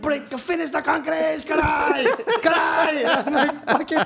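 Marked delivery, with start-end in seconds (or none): 0.0-3.0 higher, louder shouted orders; mock foreman yell. Tiago yelling at rookie to finish concrete, loud mock shout. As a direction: bark it at the rookie. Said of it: "Tiago's like".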